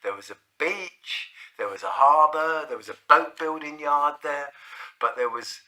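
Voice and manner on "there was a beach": normal talking voice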